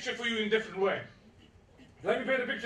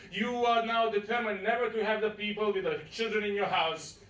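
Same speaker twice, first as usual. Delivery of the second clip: ordinary delivery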